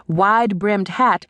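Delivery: plain reading voice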